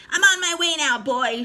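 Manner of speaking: high loud screechy call; grandma calling down to George. A narrator's reading. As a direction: call down to the boy. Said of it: "she called down".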